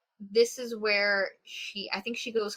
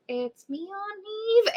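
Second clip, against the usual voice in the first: small, high, rising voice; mock timid; 12 semitones higher, slower than usual. Niece answering her possessed aunt, mock timid.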